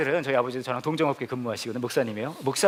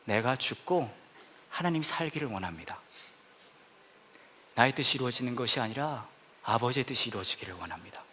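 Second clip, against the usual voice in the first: hushed prayer; 4 semitones lower, 7 dB quieter than usual. Acted. Prayerful surrender line.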